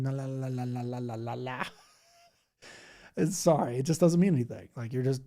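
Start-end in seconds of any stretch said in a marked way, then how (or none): 0.0-1.6 mock gibberish. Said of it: "just doing gibberish stuff".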